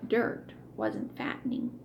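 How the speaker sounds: ordinary delivery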